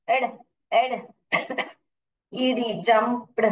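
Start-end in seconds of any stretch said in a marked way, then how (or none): none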